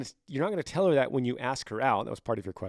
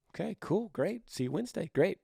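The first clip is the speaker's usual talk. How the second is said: eager agreement; 6 semitones higher than usual. Pretend. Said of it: "it's like".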